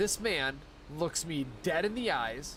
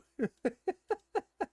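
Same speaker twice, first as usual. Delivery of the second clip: high laugh. Real, not acted. Laughing at the boss's insult, high burst.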